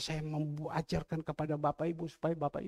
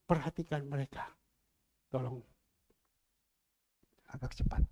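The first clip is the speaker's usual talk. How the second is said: quiet voice of the Lord; 6 semitones lower, 3 dB quieter, faster than usual. Acted. Pastor voices the Lord telling him to feed widows, low and quiet. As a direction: lower and softer, calm commanding voice. The Lord's voice again.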